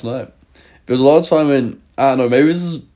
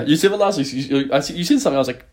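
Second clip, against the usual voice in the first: normal talking voice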